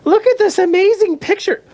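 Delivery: high, gushing coo; gushing lady. Retold or imitated. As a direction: gush over it like a proud aunt.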